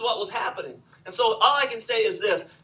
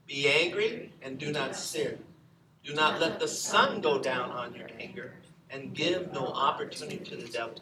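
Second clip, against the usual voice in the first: scripture reading; 6 semitones lower, 4 dB quieter than usual. Real, not acted. Congregation reads scripture together.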